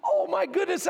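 usual speaking voice

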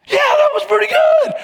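high, loud, cheerful reply; perked-up reply. Retold or imitated. Skier answering the girl's cheers, high cheerful call. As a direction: brighten up as the crowd cheers you.

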